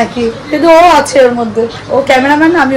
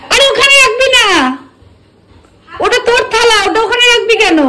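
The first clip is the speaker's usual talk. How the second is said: high scolding; 9 semitones higher than usual. Real, not acted. Scolds the girl over the plate.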